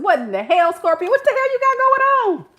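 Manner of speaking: high, rising mock outrage; incredulous outcry. Reader demanding answers from Scorpio, squealing disbelief.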